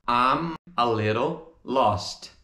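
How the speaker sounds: "I'm, a little, lost" slow with gaps; separated words. Shown as a demonstration. Teacher models the phrase before joining, slowly separated. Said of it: "veja como fica essa frase juntando".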